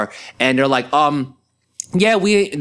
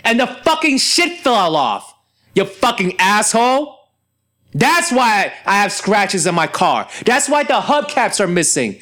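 retold angry comeback; 7 semitones higher than usual. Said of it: "I'm looking at this guy's like".

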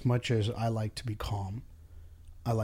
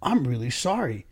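higher, louder apologetic sympathy rising to a squeak; mock sympathetic; 9 semitones higher, 4 dB louder than usual. Boss plays apologetic rep to upset customer, mock sympathy. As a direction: overdo the sorry customer service voice. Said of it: "And be like".

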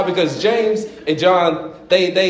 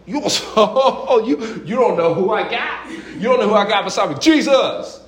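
high, laughing mock threat; puffed-up, taunting; 7 semitones higher than usual. Disciples taunting the Samaritan, high laughing mock threat. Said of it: "James and John were like".

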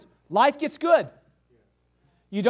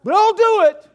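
loud, high, exasperated giving in; hammed-up resentful consent; mock grudging agreement; 9 semitones higher, 9 dB louder than usual. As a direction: burst out with put-on reluctance. Mimics the grudging worker giving in.

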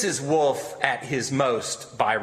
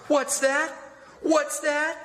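sharp, much higher demand; 12 semitones higher, slower than usual. A narrator's reading. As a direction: snap the question out high. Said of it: "said Eliza sharply".

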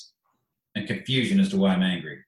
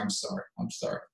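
softly imitated apology, as if crying; hushed, apologetic quote; retold tearful apology; 10 semitones higher, 9 dB quieter than usual. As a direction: murmur it small and soft, mimicking someone in tears. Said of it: "kept coming out and be crying".

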